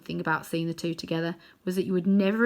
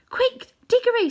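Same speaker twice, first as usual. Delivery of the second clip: high, louder urgent call; urgent girl; 7 dB louder than usual. A narrator's reading. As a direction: hurry him along. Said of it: "said Polly".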